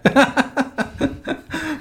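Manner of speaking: a soft high giggle after the line; giggle, caught off guard; quiet amused laugh; high breathy laugh. Real, not acted. Laughing at the joke.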